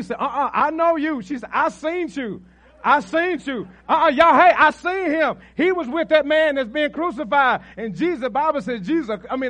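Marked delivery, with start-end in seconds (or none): 0.2-7.6 put-on loud, high accusing girl voice; a hammed-up shout of a girl pointing out Peter to the crowd. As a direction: belt it out like a girl calling him out.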